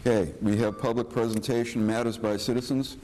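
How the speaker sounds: ordinary delivery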